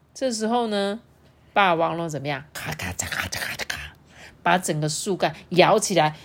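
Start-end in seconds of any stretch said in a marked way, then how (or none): 2.5-3.8 breathy imitated snapping of branches; whispered crackling. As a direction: hiss out the cracking wood. T-rex shakes the tree.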